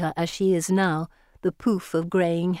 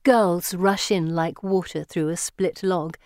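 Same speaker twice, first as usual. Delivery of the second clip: ordinary delivery, nothing marked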